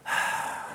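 acted long, breathy sigh; exasperated sigh. Kid answering a nagging parent, exasperated sigh. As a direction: sigh as if rolling your eyes. Acting out eye-rolling response to nagging.

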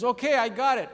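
a hint of an impatient wife answering from the kitchen; wife's reply. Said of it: "She goes".

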